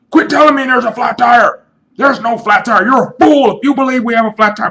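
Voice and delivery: high, loud and wild-eyed, shouting over everyone; a paranoid buddy yelling that it's terrorists; hammed-up hysterical conspiracy rant; frantic crazy friend. Crazy friend denies flat tire.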